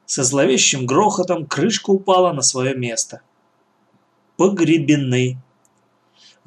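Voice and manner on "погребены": usual speaking voice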